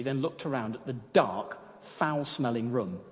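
usual speaking voice

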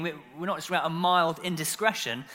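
usual speaking voice

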